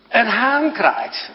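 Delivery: drawn-out clear statement; slow emphatic teaching. Shown as a demonstration. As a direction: say "Een haan kraait" very slowly, word by word. Teacher repeats slowly.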